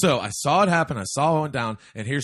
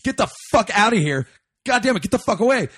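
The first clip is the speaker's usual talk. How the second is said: higher-pitched, re-enacted irritation; retold angry dismissal; 6 semitones higher, 3 dB louder than usual. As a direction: act out driving someone off in exasperation. Retells his own angry outburst.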